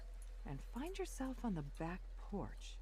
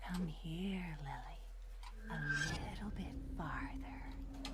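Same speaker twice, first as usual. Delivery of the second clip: cold ghostly whisper; unearthly voice; much slower than usual. A narrator's reading. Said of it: "a cold voice sounds around you".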